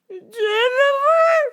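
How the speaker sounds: put-on very high, loud and slow old lady; old lady caller. Old lady caller demanding on phone, very high and slow. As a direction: quaver it out like a frail old lady.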